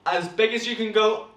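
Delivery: building to a big loud call; bigger and bigger. Shown as a demonstration. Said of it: "even bigger".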